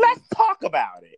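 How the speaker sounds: loud eager high call; excited. Real, not acted. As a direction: announce it with gusto.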